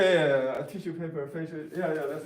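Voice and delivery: ordinary talk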